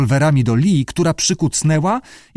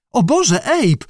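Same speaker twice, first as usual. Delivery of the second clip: high-pitched excited cry; exclaiming; 11 semitones higher, slower than usual. A narrator's reading. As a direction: cry out in high-voiced amazement. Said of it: "wykrzyknęła panna Lee".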